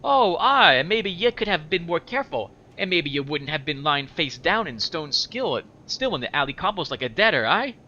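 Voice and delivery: high, sarcastic, Scottish-tinged retort. Acted. Anna throwing blame back at the corpse, high-pitched sarcasm. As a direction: pitch it up and mock the complaint. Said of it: "I'll talk in a higher pitch for her".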